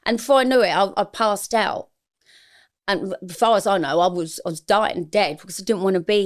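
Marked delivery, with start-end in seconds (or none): none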